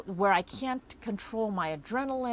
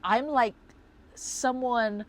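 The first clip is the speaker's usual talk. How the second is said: ordinary talk, nothing marked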